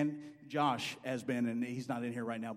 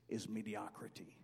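whisper; 5 semitones lower, 10 dB quieter than usual. Real, not acted. Quote ends in a whisper.

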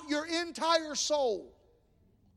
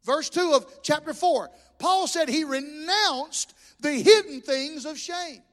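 ordinary delivery

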